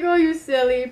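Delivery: loud teasing grandpa voice. Retold or imitated. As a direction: tease the crying child. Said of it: "He's like".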